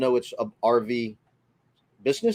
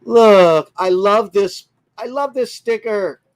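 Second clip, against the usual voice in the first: delighted; 7 semitones higher, 9 dB louder, slower than usual. Real, not acted. Showing off a loved sticker.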